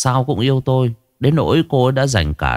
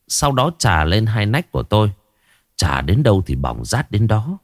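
usual speaking voice

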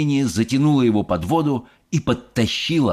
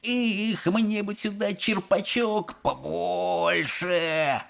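put-on high, slow child voice; greedy boy; 9 semitones higher, 4 dB quieter, much slower than usual. A narrator's reading. Boy ignores mother for chocolate, slow longing. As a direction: wish longingly, dragging the words out.

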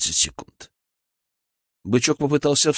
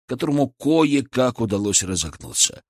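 ordinary delivery

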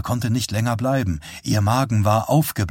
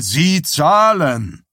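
loud, high, slow command; forceful demand; 5 semitones higher, 5 dB louder, much slower than usual. A narrator's reading. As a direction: cut him off with an order. Bernard cuts showman off, demanding payment. (drohender, lauter Befehl)

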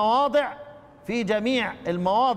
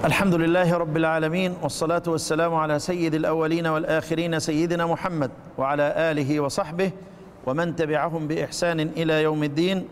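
lower, formal closing blessing; closing prayer; 5 semitones lower than usual. Acted. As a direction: bless the Prophet solemnly and evenly. (دعاء ختام بصوت خاشع منخفض)

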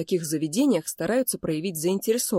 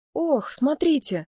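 higher, drawn-out call to look; excited exclamation; 9 semitones higher, slower than usual. A narrator's reading. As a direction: point it out eagerly. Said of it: "бросил Лео". (взволнованный возглас)

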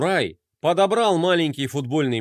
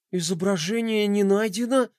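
a higher, gasped, disbelieving question; gasping in disbelief; 5 semitones higher than usual. Acted. Roma sees the missing emblem, gasping in disbelief. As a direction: gasp it as if you can't believe it. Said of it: "ахнул Рома".

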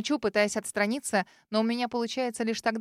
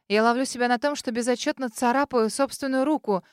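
ordinary delivery, nothing marked

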